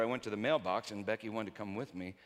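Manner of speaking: ordinary delivery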